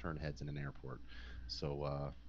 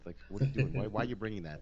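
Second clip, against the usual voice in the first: loud, scornful mock yell of a friend; scolding yell; 4 semitones higher, 8 dB louder, faster than usual. Roommate mocks him for packing a swimsuit, loud scornful yell. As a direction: bark it out like a mocking roommate.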